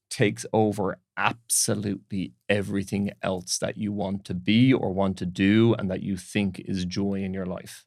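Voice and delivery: ordinary talk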